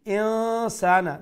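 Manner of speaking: ইনসানা with a drawn-out hidden n; ikhfa. Shown as a demonstration. Teacher demonstrating ikhfa, hidden nasal n held in ইনসানা. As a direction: hum the n into the s of ইনসানা, slow and high. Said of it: "ইখফা করে আমরা".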